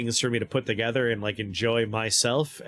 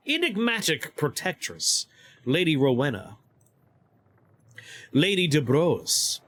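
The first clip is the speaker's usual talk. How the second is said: higher, measured storybook reading; story narration; 6 semitones higher than usual. Acted. Streamer reads the hush house legend aloud, story narration. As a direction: read it out like a grand legend.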